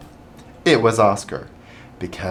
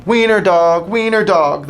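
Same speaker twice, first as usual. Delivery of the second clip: high, loud sing-song taunt; taunting dogs; 10 semitones higher, 5 dB louder than usual. A narrator's reading. Dogs mocking Oscar, high loud taunt. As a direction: jeer it like a pack of bullies. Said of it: "they called him".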